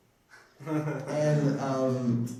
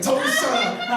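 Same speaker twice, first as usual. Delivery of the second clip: higher, louder joking "shut up"; mock shout; 8 semitones higher, 4 dB louder than usual. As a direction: snap at the recruiter in playful outrage. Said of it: "And I was just like".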